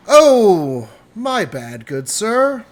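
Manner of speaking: sarcastic sorry, overdone; mock apology. Noble spilling drink on barkeep, hammed-up fake apology.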